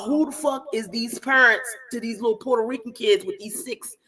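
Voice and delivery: ordinary talk, nothing marked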